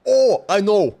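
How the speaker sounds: ordinary talk, nothing marked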